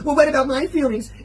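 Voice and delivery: mock wail, high and loud; squeaky mocking complaint; whining self-pity. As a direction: mimic a wounded, put-upon partner. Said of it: "And then she goes".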